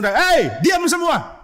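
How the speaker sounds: high, sharp order for silence; loud shout. Acted. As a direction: shout down a noisy crowd. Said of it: "Dia teriak dengan keras". (bentakan keras menyuruh diam)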